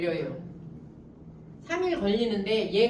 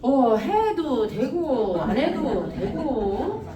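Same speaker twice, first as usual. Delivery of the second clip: slow, lazy Chungcheong country drawl imitation; mock Chungcheong drawl, slow and easygoing; 3 dB louder, much slower than usual. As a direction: stretch it out like an unbothered Chungcheong local. Mimics slow Chungcheong locals.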